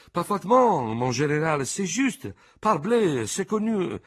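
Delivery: high, loud French cries of agreement; a crowd of old soldiers chiming in; assorted chorus. A narrator's reading. Veterans eagerly agreeing with their general, high and loud. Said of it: "un coro assortito".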